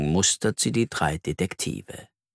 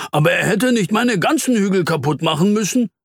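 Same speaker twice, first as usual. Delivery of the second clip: high, aggrieved protest; vexed complaint; 9 semitones higher, 3 dB louder than usual. Acted. Mole grumbles about the farmer, vexed. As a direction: protest about the ruined hills.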